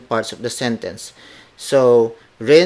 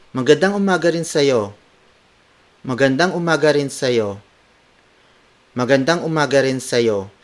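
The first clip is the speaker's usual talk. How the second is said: fluent, slightly lifted cheery greeting; 3 semitones higher than usual. Shown as a demonstration. Teacher showing good morning to you too, cheery. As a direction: return the greeting in one cheerful run. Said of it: "apply it in a cheery tone".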